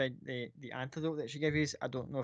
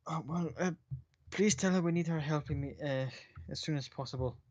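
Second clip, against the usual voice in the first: higher, hesitant giving in; flustered guard; 4 semitones higher than usual. Acted. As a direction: give in a little flustered. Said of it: "they kinda go".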